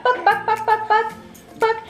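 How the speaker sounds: high clucking bak bak like a hen; chicken noises. Pretend. Mother clucking like a chicken to amuse her baby, high and playful. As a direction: cluck like a little chicken to make baby laugh. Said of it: "Like a little chicken".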